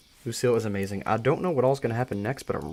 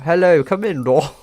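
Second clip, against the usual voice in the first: high, loud, badly faked British; butchered British accent; 7 semitones higher, 9 dB louder than usual. Shown as a demonstration. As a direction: show how badly you butcher British. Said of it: "I would butcher that so hard".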